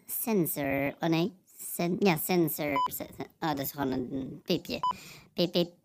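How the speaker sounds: usual speaking voice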